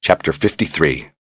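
ordinary delivery

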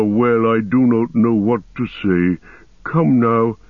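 ancient, unhurried ent voice; Treebeard voice. Acted. Treebeard hesitating.

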